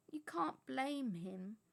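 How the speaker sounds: put-on slow, gentle old wizard. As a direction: soothe her, slowly. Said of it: "said Dumbledore gently".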